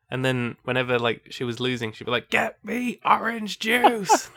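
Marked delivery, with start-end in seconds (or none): 2.3-4.3 high, slow mock command; hammed-up imperious player, pitched way up; imitated bossy demand. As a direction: drag out the order in a put-on high voice. Retold demand of a losing player.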